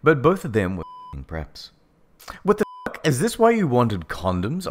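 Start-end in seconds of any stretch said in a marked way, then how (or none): 2.4-4.7 higher-pitched sarcastic question. A narrator's reading. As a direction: ask it with pointed sarcasm, starting high. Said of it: "I asked sarcastically".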